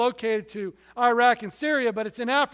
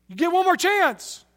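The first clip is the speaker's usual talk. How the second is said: higher, louder mock threat; gunman's threat; 7 semitones higher, 4 dB louder than usual. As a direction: give the ultimatum like the gunman. Gunmen give a final threat.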